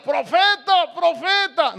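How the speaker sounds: high, drawn mock cry; grito fingido. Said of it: "empezó a gritar. ¿Cómo gritaba Eliseo?". (grito agudo de imitación)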